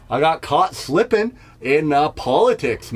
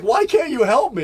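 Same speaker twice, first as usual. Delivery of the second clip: put-on kid voice; 10 semitones higher than usual. Kid asks again.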